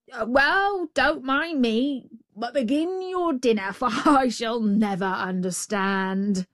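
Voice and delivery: hammed-up hopeless confusion; muddled jackal giving up. A narrator's reading. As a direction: give up in mock helplessness.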